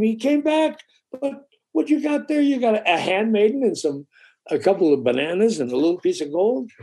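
squeaky mock disbelief from the village; astonished villagers. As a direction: pitch it up like amazed villagers. Villagers astonished at his haul.

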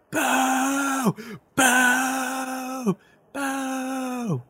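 high, jeering boos from the crowd; crowd booing. Acted. Crowd jeers at the arriving fighter, high booing. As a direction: boo them off like a hostile crowd. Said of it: "And you say".

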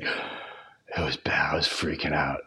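slow, very soft whispered imitation; retold whisper. Friend admitting he panicked on the flight, imitated whisper. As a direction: whisper it as if still rattled. Retelling shaken friend after edible.

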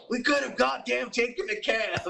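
high, mock-grumbling [name] voice. [name] repeating his limo complaint to Sharon, mock grumble. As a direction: whine it like [name]. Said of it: "And he's like".

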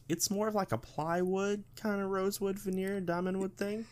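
high, mocking voice; mock corporate voice. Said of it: "And then they emailed everybody, like".

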